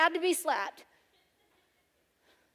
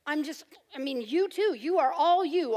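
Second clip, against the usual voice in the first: normal talking voice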